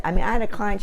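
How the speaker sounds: ordinary delivery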